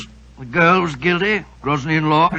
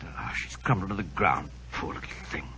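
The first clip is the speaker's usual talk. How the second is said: much softer and lower, grieving; stunned hush; 12 semitones lower, 12 dB quieter than usual. Acted. Watson watching the singer fall, soft and grieving. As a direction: murmur it as if still in shock. Just after the execution.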